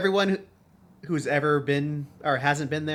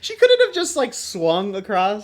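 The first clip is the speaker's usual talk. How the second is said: incredulous; 6 semitones higher, 11 dB louder than usual. Real, not acted. Mocking the gymnastics scene.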